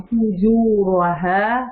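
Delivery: فجورہا stretched very slowly, thick ra, rising end; heavy ra. Shown as a demonstration. Teacher demonstrates for student, slow heavy ra. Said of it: "کیونکہ اس کے اوپر".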